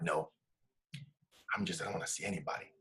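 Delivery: soft, low refusal, worn down; retold withdrawn wife. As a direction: imitate his wife hopelessly turning help away. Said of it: "And she's like".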